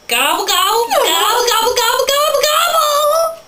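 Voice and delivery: loud gobbles climbing very high; turkey gobbling. Pretend. Playing animal noises as a turkey, gobbling wildly. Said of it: "I am a turkey".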